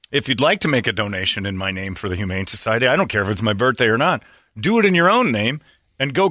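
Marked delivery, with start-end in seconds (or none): none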